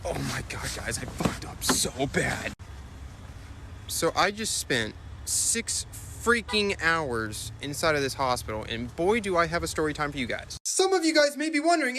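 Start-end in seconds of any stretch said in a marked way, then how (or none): 0.1-10.5 low, slow dramatic voice; deep distraught vlogger. Acted. Vlogger telling viewers how badly he messed up, low and slow. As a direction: drag it out low like a disaster.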